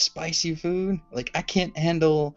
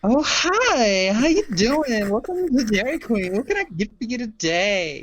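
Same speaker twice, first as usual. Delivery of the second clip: put-on high, loud Valley Girl greeting; hammed-up Valley Girl imitation, pitched way up; 6 semitones higher, 5 dB louder than usual. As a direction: gush like a Valley Girl at a drive-thru. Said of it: "he'll answer in his Valley Girl voice".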